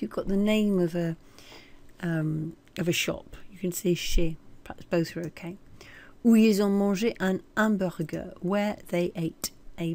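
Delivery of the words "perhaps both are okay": breathy unvoiced aside to herself; whispered aside. Real, not acted. Teacher whispering her doubt to herself about chez. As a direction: drop to a private whisper of doubt.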